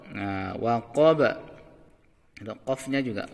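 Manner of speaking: ordinary talk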